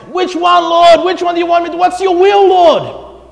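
high, loud, anguished cry to God; agonized pleading. Retold or imitated. A believer agonizing over which job God wants. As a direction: beg God frantically for an answer. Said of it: "you see Christians agonize".

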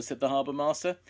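plain reading voice

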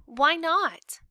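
put-on high, loud kid's protest; indignant child voice; challenging daughter. A narrator's reading. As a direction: talk back to mom as a young girl.

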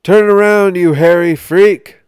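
high, loud screech. Acted. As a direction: shriek it down from the box. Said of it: "a voice screeched".

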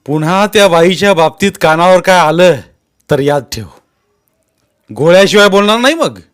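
loud, high final warning; roaring threat. A narrator's reading. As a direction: roar a last warning over him. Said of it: "ज्ञानू पुन्हा गरजला". (गरजून धमकी)

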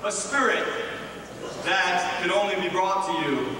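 usual speaking voice

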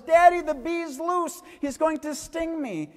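put-on high, loud frightened child's cry; little daughter crying out to her dad as the bee gets loose, panicked; panicked little girl. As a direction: cry out to Daddy in alarm.

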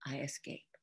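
ordinary delivery

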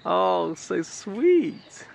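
high, loud, delighted gushing over the squirrel; thrilled squeal. Real, not acted. Watching the red squirrel shake its tail, excited high squeal. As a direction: squeal with real joy, stretching the last words slowly.

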